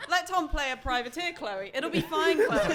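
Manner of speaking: loud, high, laughing plea. Real, not acted. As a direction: laugh through the words. GM laughing at table.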